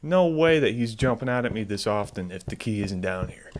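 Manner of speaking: usual speaking voice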